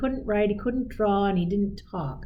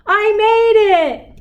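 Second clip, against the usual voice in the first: much higher and louder, a jubilant shout; Leo's triumphant cry; 13 semitones higher, 10 dB louder than usual. Pretend. Leo speaks his first sentence, a high jubilant cheer. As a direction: shout it like a kid who finally did it. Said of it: "And that sentence was".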